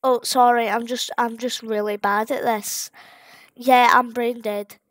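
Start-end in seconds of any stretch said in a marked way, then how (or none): none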